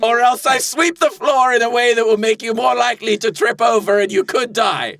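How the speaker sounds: high-pitched bargainer making a petty threat; menacing soul dealer. Acted. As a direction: threaten in hammed-up character. Deal-maker in improv scene.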